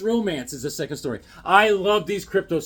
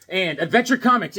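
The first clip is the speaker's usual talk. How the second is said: ordinary delivery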